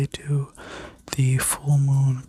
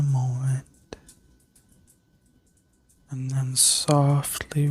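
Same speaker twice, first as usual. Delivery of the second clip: sleep story narrator. Acted. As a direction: guide them gently into rest. Leads a guided healing meditation.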